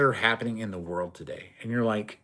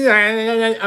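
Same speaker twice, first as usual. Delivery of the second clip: mock stammer; 6 semitones higher than usual. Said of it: "talking like this with their arms up".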